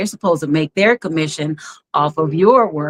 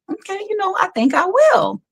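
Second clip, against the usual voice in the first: much higher, bright little yes; high and eager; 14 semitones higher than usual. Retold or imitated. Friend suggests Empire, she answers high and eager. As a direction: pitch it up high like an excited kid agreeing. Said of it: "So I was just like".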